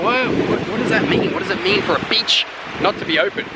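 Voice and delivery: can't believe a beach is closed; baffled disbelief. Retold or imitated. Tourist to security guard, high baffled disbelief. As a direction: voice jumps high in confusion. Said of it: "I'm like".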